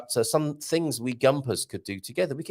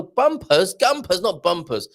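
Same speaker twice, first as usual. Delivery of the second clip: "gumpers" punched louder and higher, correcting "bumpers"; emphatic self-correction; 5 semitones higher, 6 dB louder, slower than usual. Real, not acted. Streamer fixing a misread word for the chat, "gumpers" stressed emphatically. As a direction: hit "gumpers" hard as the right word against "bumpers".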